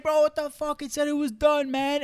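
high, loud imitation of a shocked girl; mock freaked-out girl. Imitating the girl's loud shock at the gas pump. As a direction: ham up her outrage as gas sprays everywhere.

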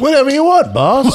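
high, eager sidekick voice; a hint of a loyal henchman; character voice. Player answers in character.

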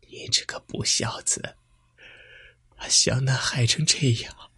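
whispered, choking with grief; tearful whisper. A narrator's reading. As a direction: hiss it through tears. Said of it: "喊道".

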